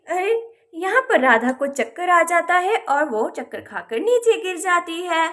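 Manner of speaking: ordinary delivery, nothing marked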